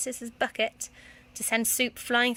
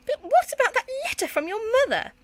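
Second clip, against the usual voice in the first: high, loud disbelief; indignant outrage; 14 semitones higher, 5 dB louder than usual. Acted. Headmistress confronting Petula, outraged. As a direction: demand an explanation.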